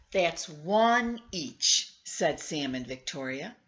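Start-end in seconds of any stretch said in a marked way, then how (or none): 0.1-1.9 slow, louder, stretched count; children counting. Acted. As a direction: drag out the final tally. Children count last cookie.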